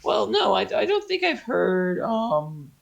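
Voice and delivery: chatty high-pitched gnome voice; high scruffy gnome. Acted. Gnome answers about golem souls, high scruffy voice. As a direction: explain in a high, squeaky creature voice. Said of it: "he sounded like this".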